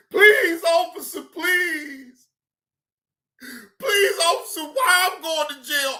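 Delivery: hammed-up high, tearful begging; mock pleading. Streamer mimicking the arrested woman, mock tearful pleading. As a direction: imitate a wailing suspect begging the officer.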